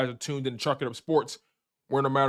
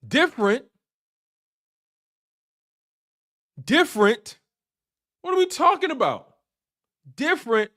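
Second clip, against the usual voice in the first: high, louder disbelieving echo; incredulous scoff; 9 semitones higher, 5 dB louder than usual. Real, not acted. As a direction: repeat the word like it's absurd. Scoffing at the word repeatedly.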